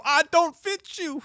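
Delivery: slow, high-pitched put-on voice; exaggerated high, drawn-out line; high mock cry. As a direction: stretch it out in a high voice. Acted quote of screaming character.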